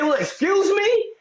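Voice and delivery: very high, sing-song sarcastic "excuse me?". Retold or imitated. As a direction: toss off "excuse me?" in a mocking squeal. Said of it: "You say".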